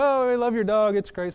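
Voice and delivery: high, bright gush over a cute dog. Retold or imitated. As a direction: coo at the hiker's dog, delighted. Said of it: "So we're like".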